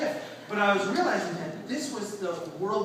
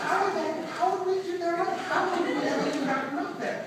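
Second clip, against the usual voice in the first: much higher and a bit louder, wide-eyed wonder; amazed kids; 13 semitones higher, 3 dB louder than usual. Retold or imitated. Orphan kids asking how it was done, amazed. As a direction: imitate kids marvelling at the impossible.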